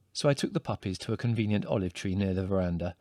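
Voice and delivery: usual speaking voice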